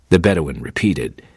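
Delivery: ordinary delivery, nothing marked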